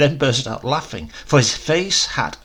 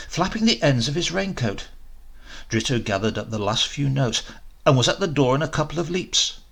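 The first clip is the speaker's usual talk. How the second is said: plain reading voice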